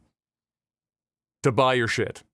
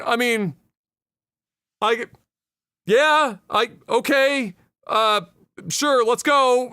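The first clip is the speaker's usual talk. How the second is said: reluctant agreement; 6 semitones higher, much slower than usual. Acted. Joe hesitantly agrees to help.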